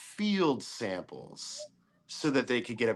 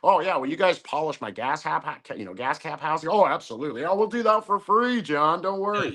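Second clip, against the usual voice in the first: eager sales voice, pitched higher on the promises; mock fawning salesman rising higher; gushing salesperson; 6 semitones higher than usual. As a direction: promise anything to close the big job. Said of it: "That's how she was talking".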